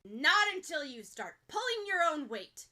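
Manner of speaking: loud, hard shot of a reply; firing back. Acted. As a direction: blast it like a cannon. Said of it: "Bakugo fires like an overpacked cannon".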